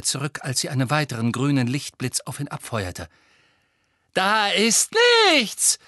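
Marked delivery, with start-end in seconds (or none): 4.1-5.8 high, loud, drawn-out call; shouted. A narrator's reading. Boy tells the witch nothing is there, shouting. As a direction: call it out loudly. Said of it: "rief er".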